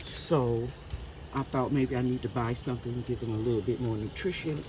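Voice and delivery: ordinary delivery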